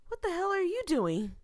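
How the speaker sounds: high, loud angry yell; a loud high outburst of fright; startled yell. Acted. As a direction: snap at the stranger who scared you. Startled character snaps at man.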